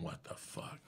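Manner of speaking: whispered to himself; a quiet retold whisper of shock; stunned whisper. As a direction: breathe it out in disbelief. Alone at a light, stunned.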